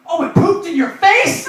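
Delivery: high, loud incredulous question; amazed outburst. Acted. Comedian guessing what the bird did, loud amazed cry. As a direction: blurt it out in disbelief.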